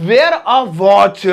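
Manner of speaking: "wear a watch" stretched slowly; very slow model phrase. Shown as a demonstration. Teacher gives the correct phrase, very slow. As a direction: drill it slowly, word by word. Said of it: "हाँ, wear a watch".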